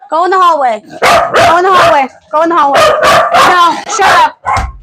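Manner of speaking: high loud orders; sharp, furious commands; fed-up owner ordering the dog out; angry command. Real, not acted.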